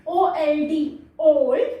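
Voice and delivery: ओल्ड said loud with a long O; phonics demo. Shown as a demonstration. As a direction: spell it then say ओल्ड clearly. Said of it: "long ओ का sound देता है".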